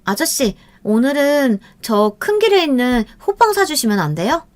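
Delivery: high, sweet coaxing schoolgirl voice; a girl sweet-talking an older man for a treat; girl wheedling. A narrator's reading. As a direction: coax him like a spoiled young girl. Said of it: "둘이 나누는 대화가 심상치 않았대요".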